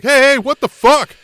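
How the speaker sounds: high, loud mock outburst, slow; hammed-up angry yell. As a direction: blurt it out like a furious wrestler. Retelling angry Hangman outburst.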